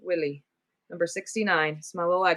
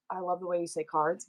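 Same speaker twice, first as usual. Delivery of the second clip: ordinary talk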